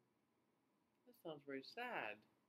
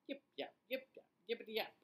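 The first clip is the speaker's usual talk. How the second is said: yappy bark; 6 semitones higher, faster than usual. Acted. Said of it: "just to bark".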